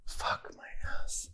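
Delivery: unvoiced, hushed curse; whispered. Real, not acted. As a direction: whisper so it won't hear. Whispering while stalking bug.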